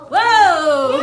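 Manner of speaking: loud, delighted cry of surprise; excited whoa. Real, not acted. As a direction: gasp at the eruption, laughing. Eruption surprises the class.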